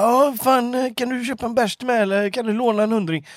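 high wheedling mock drunk; mock pub moocher. Friend mimics a begging pub regular, high mock wheedle.

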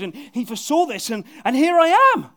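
loud, high, rising to a peak; mock Jesus declaring himself; triumphant reveal. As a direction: build to the punch of here I am. Voicing Jesus' climax.

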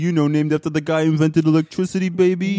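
cocky Jersey local bragging on the hometown; New Jersey accent. Pretend. Co-host echoing the guy from Edison, exaggerated New Jersey accent. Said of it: "doing your New Jersey accent".

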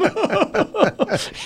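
high burst of laughter; hearty laugh; big laugh. Real, not acted. Laughs at queso joke.

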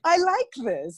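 playful gleeful echo of younger self; delighted. Retold or imitated. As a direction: relish the discovery, giggling. Repeating the delighted line.

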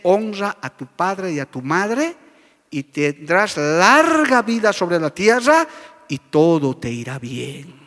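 slow, solemn Bible quote; scripture reading. Acted. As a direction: read the commandment slowly and gravely. (cita bíblica lenta y solemne)

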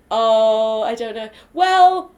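ordinary delivery

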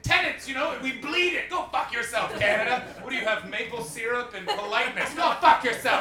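hammed-up local telling off the Canadian; mock proud Scot.

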